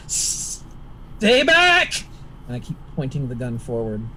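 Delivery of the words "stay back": sharp high panicked shout. Acted. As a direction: yell at it to keep away. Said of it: "I shout".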